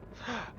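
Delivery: high, quick intake of breath; sharp gasp. Real, not acted. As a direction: gasp in surprise. Sudden reaction to the scene.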